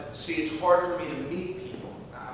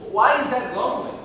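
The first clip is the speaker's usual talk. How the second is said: higher and louder, taken aback; indignant surprise; 8 semitones higher, 9 dB louder, faster than usual. Acted. To the stranger calling him lonely, loud startled challenge. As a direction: demand an explanation, stung.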